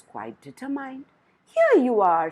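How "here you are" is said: louder, proud announcement; the queen crowing over her prize; triumphant. A narrator's reading. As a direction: present it like a victory. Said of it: "said the queen triumphantly".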